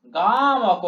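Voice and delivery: "গা" stretched long in "গামক"; drawn-out syllable. Shown as a demonstration. Teacher showing the pull, "গামক" with long-drawn first syllable. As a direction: hold the vowel of "গা", then "মক". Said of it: "গায়ে টান দিচ্ছি আমি".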